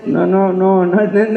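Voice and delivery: normal talking voice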